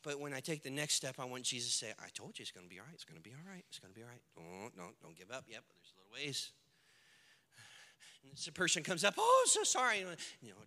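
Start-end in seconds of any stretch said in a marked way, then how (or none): 2.0-6.5 low, very soft, soothing murmur; hushed, steady encouragement; soft reassurance. Acted. Voices Jesus soothing him each step. 9.2-10.0 high-pitched, gushing sympathy; hammed-up "poor you" tone; mock condolence. Acts a mourner coming up.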